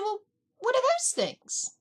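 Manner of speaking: very high, mock disbelief; incredulous. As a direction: squeak it in disbelief. Retold incredulous question.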